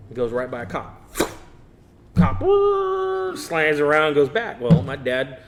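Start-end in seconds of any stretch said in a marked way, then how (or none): 2.2-3.4 siren-like whoop. Retold or imitated. Imitating cop car swerving.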